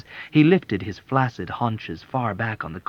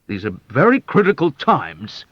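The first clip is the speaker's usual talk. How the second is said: louder, higher petulant colonel; petulant assertion; 5 semitones higher, 8 dB louder than usual. Acted. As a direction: whine it like a fretful commander. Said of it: "asserted petulantly".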